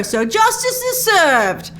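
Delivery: high, drawn-out mock declaration; imitating a man grandly absolving himself; self-righteous proclamation. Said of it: "He'd be like".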